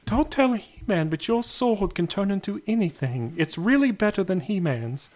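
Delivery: high, hushed conspiratorial sorceress; secretive, like sharing a forbidden secret. Retold or imitated. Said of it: "So she's like".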